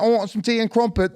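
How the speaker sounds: British accent. Shown as a demonstration. Showing the other comedian he can do a British accent. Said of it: "I can do a British accent".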